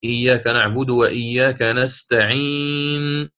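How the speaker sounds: slow recitation, stretching the last vowel of نستعين onto a still noon; recited pausal stop. Shown as a demonstration. Teacher illustrates temporary sukun when stopping, recited pausal ending. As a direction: recite the verse and hold نستعين long at the stop. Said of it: "سكونا عارضا مثل حال الوقف".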